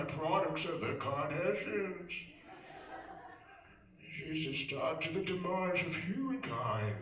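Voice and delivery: slow, hushed nature documentary voice; Attenborough narration. Retold or imitated. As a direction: narrate like a wildlife film. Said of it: "He would narrate the show".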